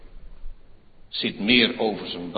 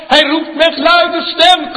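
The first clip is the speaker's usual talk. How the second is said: Eén woord loud and higher; 18 dB louder than usual. Acted. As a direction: proclaim Eén woord at full force. Preaching at full force. (luid en hoog uitgeroepen)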